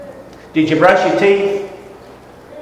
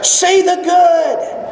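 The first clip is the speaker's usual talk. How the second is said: SEE THE GOOD stretched slow and high; drawn-out climax; 11 semitones higher, much slower than usual. Acted. Preacher urging the church, slow and soaring. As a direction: hold every word of See the good. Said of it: "climactic slow high delivery".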